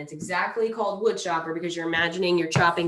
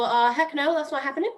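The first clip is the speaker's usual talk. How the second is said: higher, scoffing "heck no", pushed out; mock refusal; 8 semitones higher, 4 dB louder than usual. Instructor voices a viewer scoffing at the tip, mock refusal. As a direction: brush it off like a skeptical viewer. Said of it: "You might see that and go".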